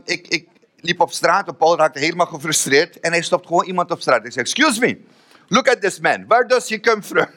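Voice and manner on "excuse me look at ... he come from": high, eager call to a stranger. Retold or imitated. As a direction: stop a passer-by in English, urgently. Friend stops stranger, calls out. (opgewonden aanroep van een voorbijganger)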